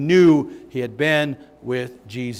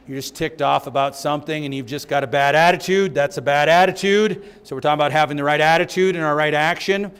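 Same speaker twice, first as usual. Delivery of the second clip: ordinary delivery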